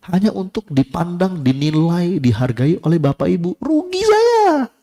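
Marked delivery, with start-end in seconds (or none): 3.6-4.7 mock lament. Mock lament of own loss.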